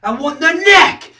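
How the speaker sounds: high, loud outburst; forceful demand. Acted. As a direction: burst out with the want. Second, explosive take.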